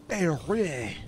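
Paris said as "Pari", no final s; "Paris" with a French Pah-REE, final s silent. Shown as a demonstration. Streamer repeating it for chat, jokey French Paris. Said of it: "That's how they say it in, in, uh, Paris".

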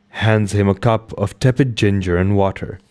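ordinary delivery, nothing marked

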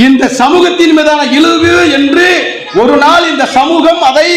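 slow, high-pitched ringing oratory; declamatory vow. Real, not acted. Orator warns that society will politicise its humiliation, declamatory. Climactic vow in a rally speech.

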